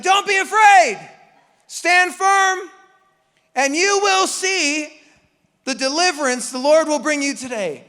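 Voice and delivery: rally the crowd at full volume; Moses shouting. Acted. Moses to the people at the sea, shouting courage.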